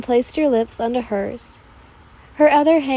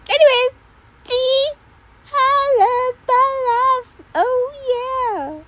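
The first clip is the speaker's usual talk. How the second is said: frazzled squeaky rant; 12 semitones higher than usual. Real, not acted. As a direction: vent to viewers in a squeaky voice. Reader breaks off, ranting to viewers.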